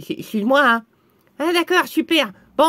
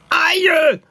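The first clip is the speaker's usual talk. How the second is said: louder, higher acted yelp; cry of pain; 5 semitones higher, 5 dB louder than usual. Spell victim jabbed in the heart, pained cry.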